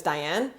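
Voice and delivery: Diane as "dye-ANN"; English pronunciation of Diane. Shown as a demonstration. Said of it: "in English, my name is".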